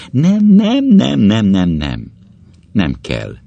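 high, loud burst of denial, falling away; agitated refusal. Acted. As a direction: push the memory away in a rapid, descending run. Inner monologue pushing away a memory.

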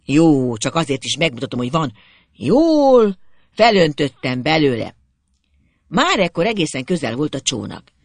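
ordinary delivery, nothing marked

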